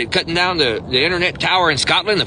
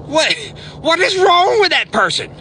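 outraged; 12 semitones higher than usual. Acted. Outraged about the tower.

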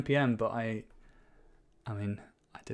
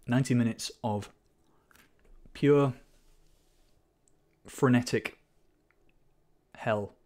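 mock eulogy; 4 dB louder than usual. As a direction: deliver a faltering eulogy. Mock funeral for deleted repo.

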